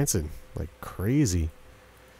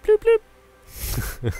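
very high playful bloop bloop; high silly sound effect; mock bloop; 4 dB louder than usual. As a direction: make the cartoon bubble noise. Imitates a game noise.

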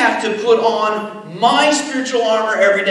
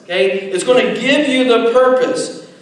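ordinary talk

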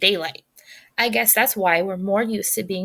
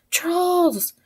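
yelling; 9 semitones higher than usual. Acted. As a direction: shout it out to warn the guards. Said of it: "he heard her yell".

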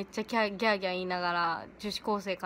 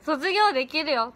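ordinary talk, nothing marked

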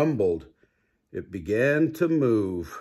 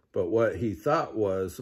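ordinary delivery, nothing marked

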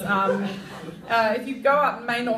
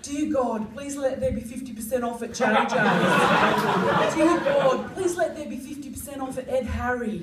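mock prayer; 4 semitones higher than usual.

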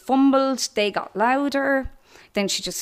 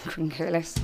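ordinary delivery

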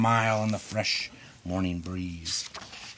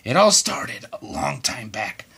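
hushed, unvoiced storyteller whisper; 4 semitones lower, 3 dB quieter than usual. Onceler whispers the old story down the hose, hushed. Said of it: "the old Onceler whispers".

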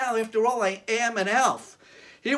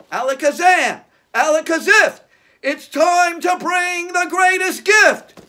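put-on kids party magician; 7 semitones higher, 9 dB louder than usual. Magic words incantation.

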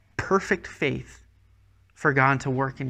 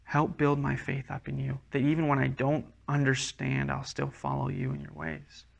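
hushed prayer, softer toward the end; simple prayer; 6 dB quieter than usual. Shown as a demonstration. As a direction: pray it quietly and simply. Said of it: "Just simply say to God".

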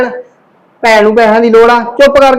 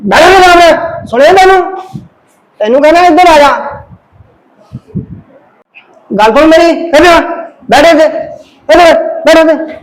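bossy commands; 5 semitones higher than usual. Acted. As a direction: order her to sit down. Husband ordering wife to sit.

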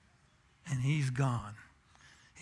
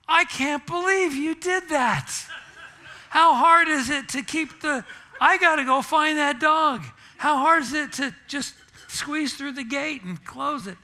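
loud, high, fed-up scolding; exasperated scolding; 11 semitones higher, 7 dB louder than usual. Retold or imitated. Husband scolding his wife over the lost dog, loud and high. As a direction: let her have it, exasperated. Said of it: "the tone of my voice".

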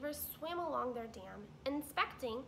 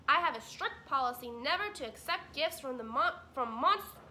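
much louder, stubborn beaver voice; grumpy beaver, louder and cut off; stubborn Mister Beaver; 4 semitones higher, 10 dB louder than usual. Acted. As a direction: insist loudly as the grumpy beaver.

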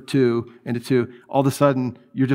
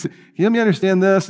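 higher-pitched, quick, polite British plea; polite British scientist; 5 semitones higher, faster than usual. Retold or imitated. British biologist asking his debate opponent for help, mock-polite. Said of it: "He's British. He's very polite".

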